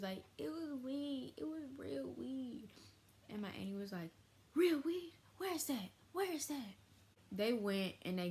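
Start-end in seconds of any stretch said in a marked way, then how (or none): 0.4-2.7 high, drawn-out caving-in voice; mock meek confession. Paris gives in, confesses. 4.5-6.7 high-pitched mock outraged demand; imitated heated auntie. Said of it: "she was heated".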